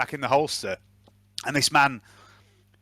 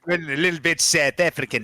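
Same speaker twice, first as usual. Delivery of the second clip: higher-pitched mock South African accent; 4 semitones higher than usual. Game master owns up to the slip, in mock South African accent. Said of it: "It's gone South African for a minute".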